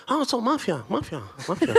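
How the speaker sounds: high, teasing singsong of mafia. Retold or imitated. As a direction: mimic the people who tease him about Sicily. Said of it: "the first things that I've told is".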